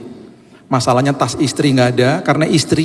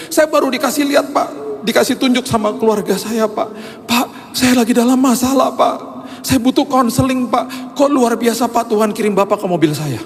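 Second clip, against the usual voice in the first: tearful pleading; 11 semitones higher than usual. Retold or imitated. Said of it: "Terus dia nangis".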